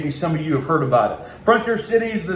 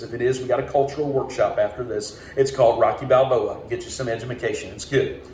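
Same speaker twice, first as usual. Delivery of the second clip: ordinary talk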